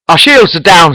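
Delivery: shouted report. A narrator's reading. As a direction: call out bad news mid-battle. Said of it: "shouts Lieutenant Wright".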